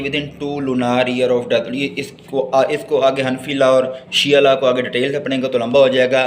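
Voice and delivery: ordinary talk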